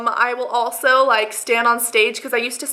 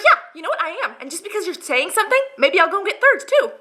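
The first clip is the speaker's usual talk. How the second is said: high-pitched, sassy retort; defiant comeback; 7 semitones higher than usual. Retold or imitated. Answering people who question her second helping, defiant. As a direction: push back with mock defiance.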